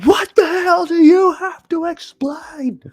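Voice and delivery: high, slow mock yell of a furious father; hammed-up furious dad; shouting dad, exaggerated and high; imitating angry dad. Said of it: "He's like".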